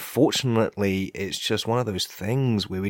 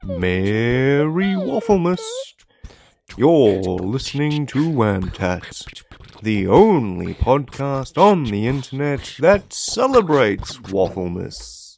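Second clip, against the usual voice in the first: festive announcer voice; 4 semitones higher, 4 dB louder, slower than usual. Pretend. Mock festive podcast intro.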